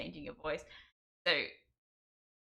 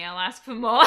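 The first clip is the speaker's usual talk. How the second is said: laughing; 12 semitones higher, 16 dB louder than usual. Real, not acted. Joking, breaks into laughter.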